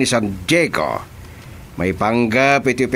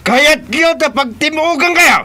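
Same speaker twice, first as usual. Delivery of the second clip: loud, high furious yell; angry shout; 5 dB louder than usual. Acted. Boss yelling at his men, loud angry shout. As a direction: bellow at them to obey.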